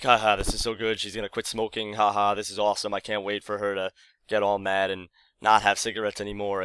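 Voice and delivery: ordinary talk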